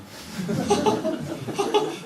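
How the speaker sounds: high, breathy manic laugh; crazy laugh. Retold or imitated. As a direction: laugh like you've lost it. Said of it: "미쳐서 웃는 거예요". (미친 듯한 높은 웃음)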